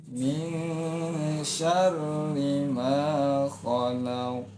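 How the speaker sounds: Min syarri ma khalaq chanted slowly on one level note. Shown as a demonstration. Teacher demonstrating the flat second-verse tone. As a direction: hold khalaq flat, do not raise it. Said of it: "Tidak dinaikkan, ya, datar".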